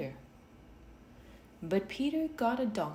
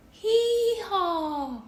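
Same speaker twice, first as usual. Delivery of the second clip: much higher, loud hee-haw bray; donkey bray; 8 dB louder than usual. A narrator's reading. The donkey joins the noise, a high loud bray. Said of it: "The donkey said".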